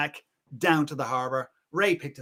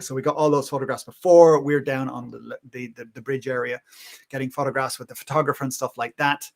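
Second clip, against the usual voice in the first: usual speaking voice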